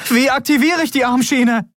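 high, urgent shouted question; panicked, high-pitched call. A narrator's reading. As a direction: call out desperately over engine noise. Urgent question shouted over engine noise.

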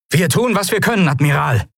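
high, strained captain's reply; urgent promise. A narrator's reading. Acting captain answers the admiral under fire, urgent high promise. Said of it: "versprach der zeitweilige Kapitän".

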